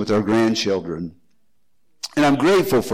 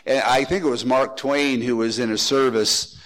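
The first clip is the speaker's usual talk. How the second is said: ordinary talk, nothing marked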